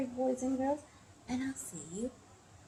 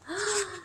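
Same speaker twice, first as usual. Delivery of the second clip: high mock gasp; shocked gasp; 11 semitones higher than usual. Storyteller reacts to the thieving monkeys, shocked gasp. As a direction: suck in a breath for effect.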